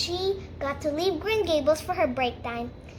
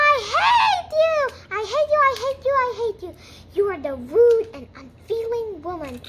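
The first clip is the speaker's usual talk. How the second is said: high furious shout, easing into loud scolding; 8 semitones higher, 6 dB louder than usual. Acted. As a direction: yell it in a stomping tantrum. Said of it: "very loud voice. The more she stomped, the more louder".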